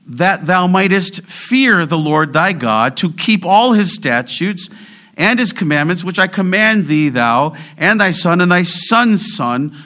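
solemn, emphatic recitation; scripture proclamation. Acted. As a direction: declaim the commandment with weight, easing at the close. Reads scripture aloud.

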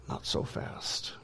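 whispered, slow check; slow whispered word of warning; hushed caution. Acted. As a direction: barely whisper it, drawn out. Preacher checks the disciples' claim.

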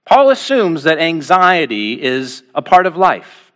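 ordinary delivery

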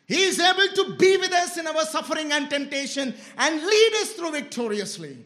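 loud, high-pitched sermon delivery; impassioned preaching. Real, not acted. Preacher to congregation on Christ's help, impassioned. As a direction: preach it out with full force.

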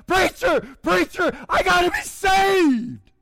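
high, loud cry, slowing on "I gotta be saved"; a sinner crying out to the preacher, desperate. Retold or imitated. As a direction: shout it from the burden of sin. Jim desperate under conviction.

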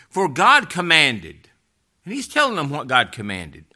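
loud, slow, weighty scripture reading; forceful preaching. Real, not acted. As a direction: hammer out each phrase to the congregation.

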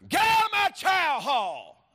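high, loud drawn-out bellow; drill sergeant scream. Retold or imitated. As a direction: scream the order across a mess hall. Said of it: "Who was screaming in here?".

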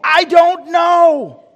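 high, loud, slowed exclamation; emphatic shout. Acted. Preacher answers the people's question, shouting slowly.

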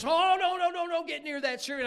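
high, loud protest; mock-horrified mother stopping someone; alarmed mom. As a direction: cry out a panicked refusal. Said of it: "and Mom says".

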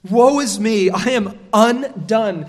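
loud, despairing outcry; Isaiah crying out before God's holiness; anguished cry. Acted. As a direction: cry out as a man undone. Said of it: "That word for lost".

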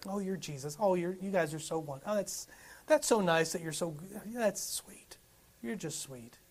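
hushed, falsely admiring coo; patronizing mock sweetness. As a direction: imitate someone politely humouring a believer, softly. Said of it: "That's what the world's like sometimes".

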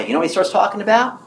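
normal talking voice

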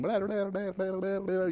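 a light mock babble of kids chattering; mimicking chatter. Dad mimicking the kids chattering in the back of the car, light mock babble. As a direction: rattle off blah blah like endless backseat chatter. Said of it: "they're chatterboxing".

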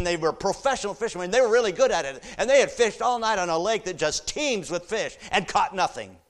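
loud, high, driving preacher's voice; full-force preaching. Real, not acted. Preacher rousing the church over the verse, loud and high.

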